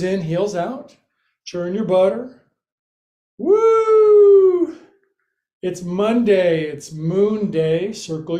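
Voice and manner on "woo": loud, high whoop; excited woo. Real, not acted. Yoga teacher hyping up the class, loud excited whoop. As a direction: let out a big joyful cheer. Cheering mid-workout.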